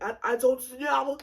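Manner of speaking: mock slight French accent. Date unsure what they should do, mock French accent. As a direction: imitate him in a light French accent. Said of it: "He had a slight French accent".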